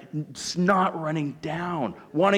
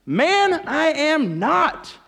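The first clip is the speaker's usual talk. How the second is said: high, loud, drawn-out protest; acted shout of protest; forceful denial; 10 semitones higher, 7 dB louder, much slower than usual. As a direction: slowly hammer out the denial. Peter's second denial, acted.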